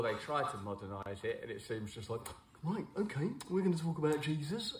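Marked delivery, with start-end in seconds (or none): 2.6-4.8 higher, hammed-up trendy youth pastor; goofy trendy preacher. Brand mocking modern church in a goofy voice. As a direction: play the eager modern vicar, goofy. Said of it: "he kinda has this sort of, goofy kind of Southern".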